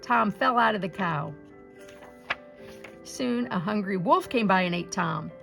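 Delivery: ordinary delivery, nothing marked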